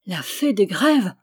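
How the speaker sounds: astonished question, timbre changed from the narration; stunned echo. A narrator's reading. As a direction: repeat it, dumbfounded. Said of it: "répétèrent les deux bonnes gens stupéfaits". (écho stupéfait)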